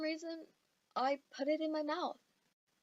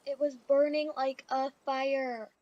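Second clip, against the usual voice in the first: ordinary delivery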